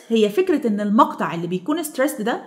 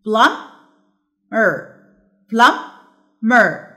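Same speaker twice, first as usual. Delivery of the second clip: plumber split into two separated beats; syllable by syllable; 4 dB louder, much slower than usual. Shown as a demonstration. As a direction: pause between the syllables of plumber. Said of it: "نشوف كده". (مقطّعة إلى مقطعين بينهما سكتة)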